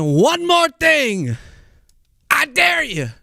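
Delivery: growled threat. Retold or imitated. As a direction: rasp out a tough-guy dare. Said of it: "If it didn't hurt my throat so much".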